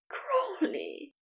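high, soft gasped name; breathless cry. A narrator's reading. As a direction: let the name escape, high and hushed. Overwhelmed lover cries out the name.

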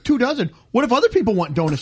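high, fast indignant squawk at the order; mock outrage at the order size; indignant disbelief. Donut lady balks at a two-dozen order, indignant. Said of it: "She goes".

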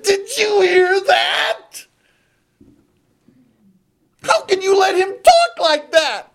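high-pitched wail of outrage; indignant young David. Acted. David appalled that they let Goliath talk, indignant. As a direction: cry out high, stretched with disbelief.

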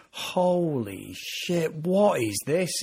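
drawn-out, slow disbelief; stunned reaction. Retold or imitated. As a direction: retell being stunned, stretching the words out. Retelling his stunned first reaction.

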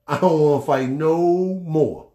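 I'm done with fighting, said loud and slow; mock yell. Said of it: "I said".

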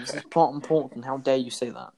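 usual speaking voice

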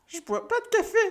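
high-pitched, fretful wail; distressed, voice way up high; panicked confession. Acted. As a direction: blurt it out in a squeaky panic. Panicked twin confesses his worry.

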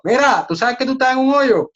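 high, loud mock-scolding; mocking judgment. Imitating someone scolding a person already in trouble, high and loud mock. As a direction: sneer down at the person in the hole.

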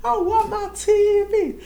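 high, slow whiny mock wail; mock screaming ex-tenant. Evicted man at the door demanding his TV, high slow mock wail. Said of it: "screaming on the door".

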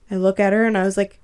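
ordinary talk, nothing marked

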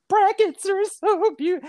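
high, slow, tearful wail; mock sobbing. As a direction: sob it out, choked up over beauty. Crying over the bougainvillea.

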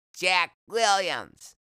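put-on high, loud, drawn-out kid's answer; young boy's voice. A narrator's reading. Boy introducing himself to a rough patient, high loud kid voice. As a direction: pipe up slowly as a young boy.

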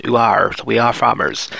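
ordinary talk

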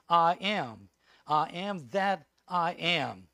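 slow, weighty I am that I am; solemn proclamation. Acted. Preacher proclaiming God's name to the congregation, slow and weighty. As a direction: lean on each word, slowly.

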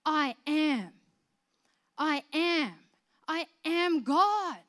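slow, weighty, each I am hammered; slow down and land every word; emphatic repetition. Acted. Preacher repeating Jesus' claim, slow and emphatic.

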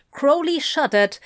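usual speaking voice

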